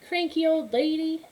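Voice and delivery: put-on high, loud taunting kids; mocking children. Village children jeering at Befana, high loud mocking.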